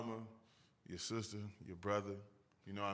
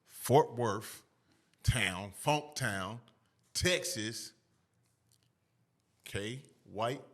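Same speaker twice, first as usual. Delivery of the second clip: big slow hype-man announcement; announcer voice; 5 dB louder, much slower than usual. Acted. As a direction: call it out like a ring announcer. Hype introduction of the guest.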